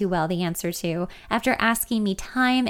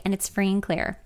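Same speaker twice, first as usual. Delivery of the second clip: ordinary delivery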